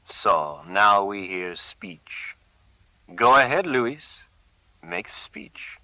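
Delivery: slow, mocking, ending in a hush; thin sarcasm. Gerardo mocking Luis, sarcastic. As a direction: sneer at him. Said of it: "with thin sarcasm".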